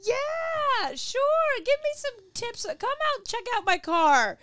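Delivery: high, loud, gushing self-parody; naive eagerness. Retold or imitated. Woman happily agreeing with a guy flirting, loud and eager. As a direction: belt it out high, cluelessly keen. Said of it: "been like".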